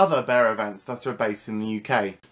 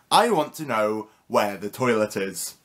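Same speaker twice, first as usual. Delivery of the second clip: ordinary delivery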